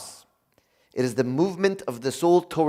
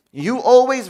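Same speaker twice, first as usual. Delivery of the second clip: higher, louder accusing "You always"; accusing criticism; 8 semitones higher, 6 dB louder than usual. Shown as a demonstration. The preacher contrasting blaming criticism with feelings. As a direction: punch "You always" as blame.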